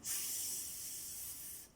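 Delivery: "s" hissed like meat frying; sizzling s. Shown as a demonstration. Teacher to pupils, sizzling like frying meat. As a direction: hiss "s" like a sizzling steak. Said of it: "así suena la S".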